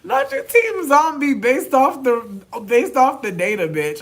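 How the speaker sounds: high, loud disbelieving yell; outraged shout. Retold or imitated. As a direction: shout it at her in mock outrage. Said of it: "I was like".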